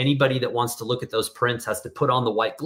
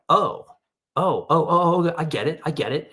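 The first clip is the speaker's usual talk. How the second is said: dawning realization; 4 semitones higher than usual. Retold or imitated. Border agent suddenly understands.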